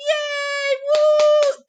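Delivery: sarcastic high-pitched yay and woo; mock cheer. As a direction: fake celebration, squealed up high.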